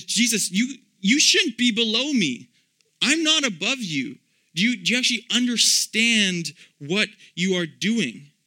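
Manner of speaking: high, loud, urgent protest; Peter protesting. Acted. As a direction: plead with Jesus not to kneel. Peter protesting Jesus washing feet.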